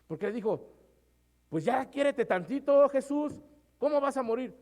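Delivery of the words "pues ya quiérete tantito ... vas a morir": high, louder pleading protest; Pedro protestando. Retold or imitated. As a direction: beg him not to die, pushing hard. Pedro le dice a Jesús. (Pedro suplicando a Jesús en voz alta y aguda)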